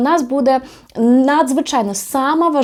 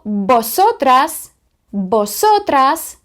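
stretched, carefully sounded Spanish word; teacher's deliberate, drawn-out Spanish; slow model pronunciation; 3 semitones higher, much slower than usual. Shown as a demonstration. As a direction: say it slowly for learners to repeat.

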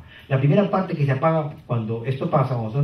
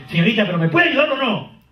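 impatient customer; 7 semitones higher than usual. Retold or imitated. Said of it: "Esa gente que está así".

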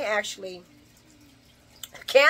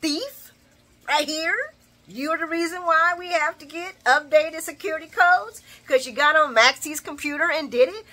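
higher, louder sassy accusation; imagined callout; 7 semitones higher, 4 dB louder than usual. Retold or imitated. Vlogger imagining calling out Brookie as the thief, sassy and raised. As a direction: point the finger and call her out. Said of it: "I'm like".

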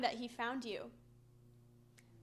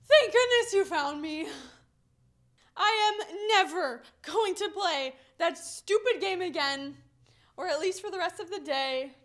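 relieved gush; 3 semitones higher, 6 dB louder than usual. Retold or imitated. Found child telling her dad she's relieved, loud and gushing. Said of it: "I was like".

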